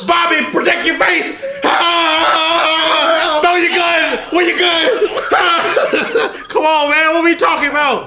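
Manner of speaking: high loud yelling through laughs; laughing shout. Pretend. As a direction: yell through laughter at Bobby. Yelling advice at story characters.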